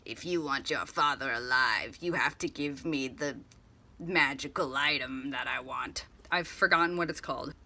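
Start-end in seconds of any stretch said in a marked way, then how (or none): 0.0-6.0 creepy mysterious voice. Retold or imitated. Kidnapper threatens Artemis over the phone, creepy low voice. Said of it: "all creepy and mysterious".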